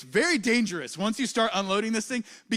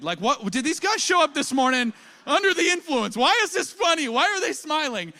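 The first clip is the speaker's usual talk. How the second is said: high, loud, baffled questioning; can't believe they're laughing under that weight; mock-outraged high-pitched disbelief; incredulous disbelief; 8 semitones higher, 6 dB louder than usual.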